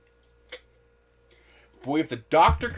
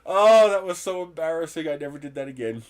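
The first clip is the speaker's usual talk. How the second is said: usual speaking voice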